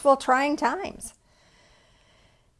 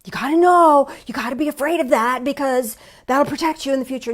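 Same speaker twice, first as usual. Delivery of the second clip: louder, higher, pushy fear voice; negativity bias voice; 3 semitones higher, 6 dB louder than usual. Acted. Preacher voicing the fearful negativity bias, insistent. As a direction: sell the fear like a nagging inner voice. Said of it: "That's that negativity bias going".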